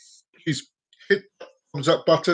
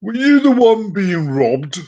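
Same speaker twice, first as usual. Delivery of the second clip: slow, loud, higher-pitched policeman; sceptical cop; 5 semitones higher, 5 dB louder, slower than usual. Acted. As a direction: question him slowly and loudly, doubting. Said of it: "gives him this skeptical look".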